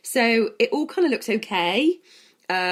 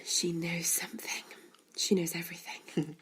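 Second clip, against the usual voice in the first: hushed conspiratorial whisper; playful secretive whisper; mock whisper; 2 semitones lower, 12 dB quieter than usual. Conspiratorial repeat of cohost's line.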